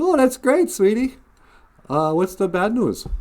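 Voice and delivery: high, loud sweet mom. Acted. Mother hearing her son's news, high sweet mom voice. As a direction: play the cheerful mother. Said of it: "And she goes".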